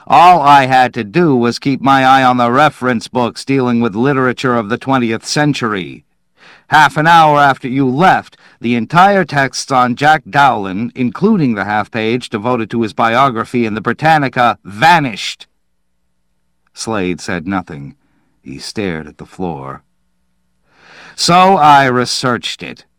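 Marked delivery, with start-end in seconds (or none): 0.1-15.5 louder, higher cutting reproach; biting. A narrator's reading. Manville explaining to Slade what was lost, biting. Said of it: "Manville said bitingly". 21.2-22.8 louder, higher accusing tone; biting reproach. A narrator's reading. Manville reporting his research to Slade, biting.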